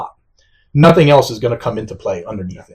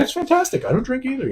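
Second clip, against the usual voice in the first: imitating wife; 12 semitones higher, 7 dB quieter than usual. Quoting wife Gail's reply.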